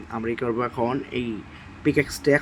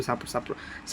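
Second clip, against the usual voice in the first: whispered plea; 11 dB quieter than usual. Real, not acted. Youtuber whispering a plea for support to viewers, whisper. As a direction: whisper the word like a secret.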